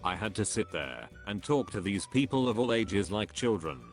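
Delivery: ordinary talk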